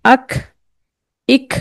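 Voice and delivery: अख with air escaping after the stop; Qaf with breath. Shown as a demonstration. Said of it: "तो कैसे पढ़ेंगे?".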